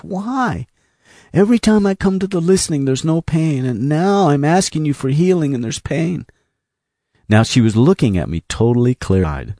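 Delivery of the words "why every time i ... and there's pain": high, anguished complaint; crying out. Retold or imitated. Woman asking Jesus why there is pain, anguished cry. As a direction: plead why, pitched high and pressing on. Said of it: "I began to cry out to Jesus".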